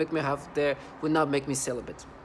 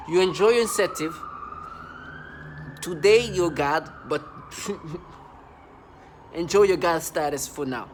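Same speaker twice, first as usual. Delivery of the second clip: angry rant; 4 dB louder than usual. Real, not acted. Ranting at perpetrators.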